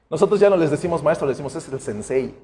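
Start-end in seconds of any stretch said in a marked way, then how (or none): 1.6-2.3 "sensei" dropped low and soft as a mock honour; sarcastic sensei. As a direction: tease the old painter who thinks he's above all. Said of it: "Sarcásticamente".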